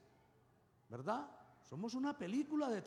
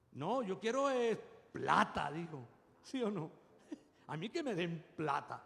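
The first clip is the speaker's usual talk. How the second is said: lightly voiced greedy brother, dismissive; Esaú voice; 3 semitones lower than usual. Preacher mimics Esaú choosing money over blessing, mocking.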